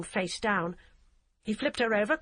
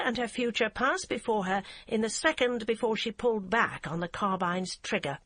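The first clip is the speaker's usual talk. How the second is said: ordinary delivery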